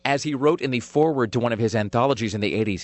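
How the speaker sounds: ordinary talk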